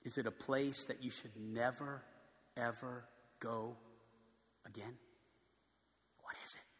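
low, soft, searching questions; hushed preaching. Acted. Preacher asking the congregation their anchor, hushed. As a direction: lean in and ask it quietly.

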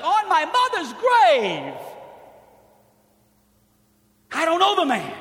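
swearing an oath. Retold or imitated. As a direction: swear it on your mother's grave with full force. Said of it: "he began to invoke a curse on himself".

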